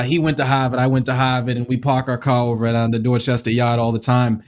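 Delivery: put-on Boston accent, dropped R's. Guest quoting the beer commercial, Boston accent. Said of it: "like, Boston accents".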